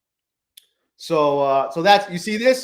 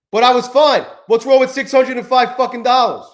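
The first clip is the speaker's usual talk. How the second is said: much louder, higher exasperated rant; angry outburst; 7 semitones higher, 7 dB louder than usual. Real, not acted. Trader yelling to viewers about giving back profit, loud angry. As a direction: shout at yourself in disbelief. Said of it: "So fucking annoyed about that".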